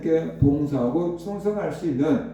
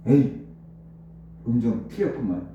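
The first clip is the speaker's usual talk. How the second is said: lower-pitched mock grumble of a critic; sneering; 7 semitones lower, 3 dB quieter than usual. Churchgoer mocking the singer's pitch, sneering. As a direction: act out a dismissive jeer. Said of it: "사람들이 비아냥 거릴 수도".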